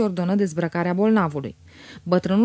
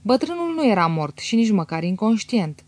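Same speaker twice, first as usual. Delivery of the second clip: ordinary delivery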